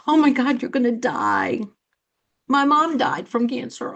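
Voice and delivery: mock panic at bad news; panicked blurt. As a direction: imitate someone who overreacts from fear. Fearful reaction to cancer news.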